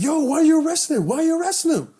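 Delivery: high, fast, loud challenge to police; retold indignant protest. Protesting friend's arrest, retold.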